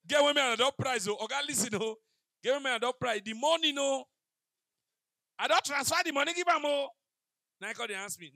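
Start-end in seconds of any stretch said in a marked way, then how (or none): 0.1-6.9 loud pleading demand, voice up high; mock demanding girl. A girlfriend loudly pressing Oga for money, mock demanding. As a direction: push the line at him like a greedy girlfriend.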